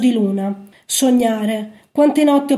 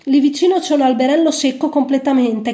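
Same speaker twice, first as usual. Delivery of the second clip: ordinary delivery, nothing marked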